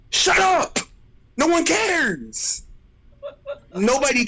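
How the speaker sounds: fed-up outburst at bragging players; exasperated yell. Real, not acted. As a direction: blast them, then drag out no one cares. Ranting at OC fans.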